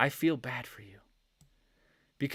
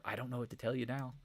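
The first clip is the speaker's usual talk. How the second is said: ordinary delivery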